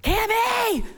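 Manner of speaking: high, frightened shout; frightened mom calling for her missing child; scared call. Retold or imitated. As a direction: call out in real fear. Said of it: "finally I'm getting scared".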